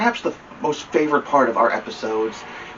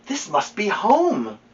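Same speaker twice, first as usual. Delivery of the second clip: wondering bear; 8 semitones higher, slower than usual. Acted. Corduroy sees the room, slow awed voice.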